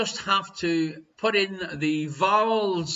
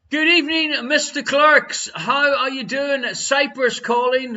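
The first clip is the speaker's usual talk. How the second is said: loud greeting call; 6 semitones higher, 6 dB louder than usual. Real, not acted. Host greets caller on stream.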